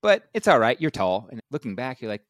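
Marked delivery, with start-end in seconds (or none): 0.0-1.3 mock well-meaning friend. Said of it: "that's a person saying".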